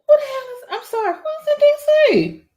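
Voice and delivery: very high, baffled squeal; real disbelief sending the voice way up; high-pitched excitement. Real, not acted. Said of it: "I get higher when I get excited".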